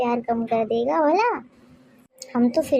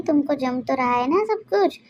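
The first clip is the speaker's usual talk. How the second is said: usual speaking voice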